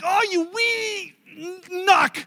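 high, drawn-out mock exasperation, punched last word; frustrated outburst. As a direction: act out a fed-up card partner, stretching it, then bursting loud. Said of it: "he gets so frustrated".

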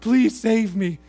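loud, strained acted plea; mock desperate prayer. Fallen hiker praying from a branch, mock desperation. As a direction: play a man begging to be saved.

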